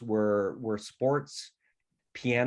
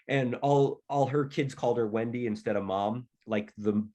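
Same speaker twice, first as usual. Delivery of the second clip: normal talking voice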